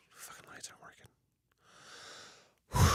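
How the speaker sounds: barely audible, voiceless whisper; whispered disbelief. Retold or imitated. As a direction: whisper the swearing to yourself. Retelling his discovery of dead lights.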